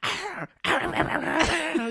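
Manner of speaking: put-on high, whiny childlike ah-ah cries; hammed-up toddler tantrum; mock tantrum wails. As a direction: wail like a kid kicking on a bed. Cage's tantrum on the bed.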